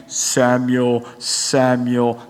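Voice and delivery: very low, solemn calling voice; voice of God. Acted. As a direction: call a sleeper in a deep divine voice. Acts God calling Samuel.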